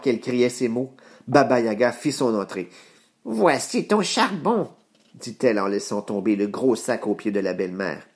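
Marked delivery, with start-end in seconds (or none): 3.2-4.7 high, slow witch voice. Acted. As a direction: drawl it out like an old witch. Baba Yaga drops the coal.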